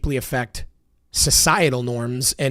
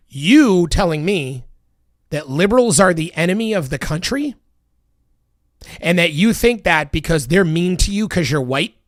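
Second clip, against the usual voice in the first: louder and higher, heated; heated, raised-voice rant; angry rant; 3 semitones higher, 4 dB louder than usual. Real, not acted. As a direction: lay into him with rising anger.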